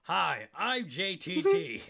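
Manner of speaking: high, slow and loud; hammed-up kid star; Jonathan Taylor Thomas voice. Mocking JTT impression.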